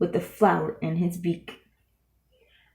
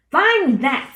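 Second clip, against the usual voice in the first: sneering; 6 semitones higher, 6 dB louder than usual. A narrator's reading. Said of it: "sneered the bird".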